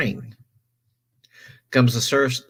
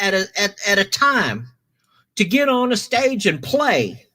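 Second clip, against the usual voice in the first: retold editor; 12 semitones higher than usual. Retold editor scoffing at the plan.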